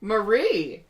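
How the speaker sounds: high, loud mock gushing; swooning delight at a compliment, played up; flattered gushing. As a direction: gush as if hugely flattered. Acting flattered reply to compliment.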